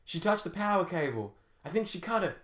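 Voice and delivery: high-pitched yell, no extra volume; yelling rifleman. A narrator's reading. Rifleman warning the others about the sabotaged crane, restrained high yell. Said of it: "yelled one of the riflemen".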